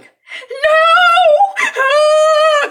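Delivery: very high, loud drawn-out wail; imitated anguished scream. Katie screaming for the dog left inside, hammed-up. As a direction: act out a girl screaming in horror.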